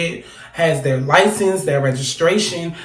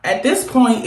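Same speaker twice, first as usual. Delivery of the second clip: ordinary talk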